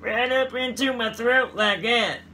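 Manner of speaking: high, throaty hillbilly voice; hillbilly accent. Pretend. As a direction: pull the hillbilly voice up from the gut. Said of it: "pulling my guts up".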